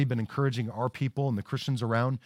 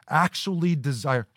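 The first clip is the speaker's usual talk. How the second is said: ordinary talk, nothing marked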